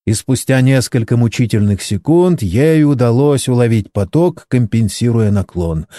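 plain reading voice

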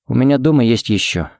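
ordinary delivery

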